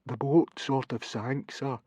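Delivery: slow, quiet, sheepish confession; small, sorry voice; Fishlegs, miserable. A narrator's reading. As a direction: admit it softly and reluctantly. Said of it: "said Fishlegs miserably".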